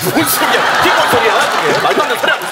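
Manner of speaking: loud, high incredulous protest; indignant outburst. Acted. Comedian protesting partner's breakup line, indignant outburst. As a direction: explode at the nonsense.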